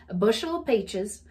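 Southern "peaches" said "pay-ches"; country Southern bushel phrase; Southern accent. Shown as a demonstration. Offering the country version, Southern accent. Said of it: "heavy Southern accent".

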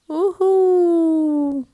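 long, drawn-out high whoop of joy; delighted whoop. Acted. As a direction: stretch out the thrill. Kid delighted bouncing on bed. (صيحة فرح ممدودة)

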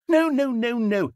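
exasperated correction; alarmed correction. Acted. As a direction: stop the player right there. Narrator corrects the player.

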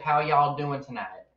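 slow Texas drawl on y'all. Shown as a demonstration. Showing mom a slow Texas twang. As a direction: stretch it out like a Texan. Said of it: "you gotta be like".

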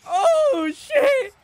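high, loud burst of hype; hyped exclamation. Real, not acted. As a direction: yelp it out in excitement. Hype reaction.

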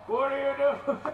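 laughing through the question. Real, not acted. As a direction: giggle as you ask. Laughing at Crystal.

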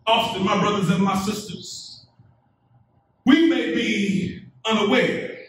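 ordinary delivery

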